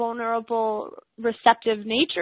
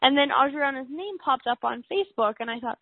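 ordinary delivery

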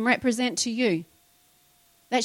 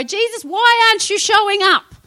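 higher pitched, pleading complaint to Jesus; exasperated plea; 10 semitones higher, 4 dB louder than usual. Retold or imitated. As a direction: throw up your hands and ask why he is late. Acted prayer of frustrated believers.

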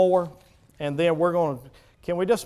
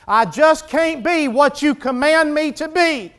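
high, raised-voice protest; exasperated outburst; 9 semitones higher, 7 dB louder than usual. Acted. Preacher acts lame man refusing God's command, exasperated outburst. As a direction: burst out in helpless protest. Lame man's excuses reach a climax.

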